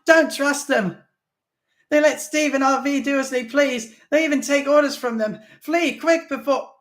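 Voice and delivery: panicked screaming plea; screamed warning. A narrator's reading. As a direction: scream at him to flee.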